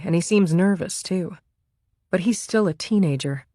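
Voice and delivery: ordinary delivery, nothing marked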